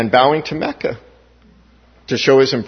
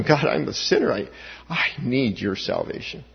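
dropping softer into earnest prayer; humble prayer; 8 dB quieter than usual. Acted. Sinner pleading with God for salvation, humble and soft. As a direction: confess it softly to God. Preacher voices his own prayer.

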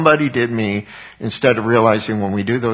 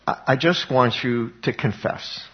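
ordinary talk

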